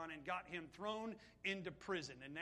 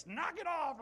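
higher, mock-scolding knock it off; 7 semitones higher, 5 dB louder than usual. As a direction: brush him off like he's joking. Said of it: "Melita, she said".

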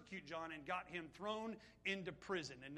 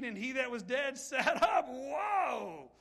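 louder, laughing through the words; louder laughing delight; 4 semitones higher, 9 dB louder, slower than usual. Real, not acted. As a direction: read it laughing with joy. Laughs reading the miracle.

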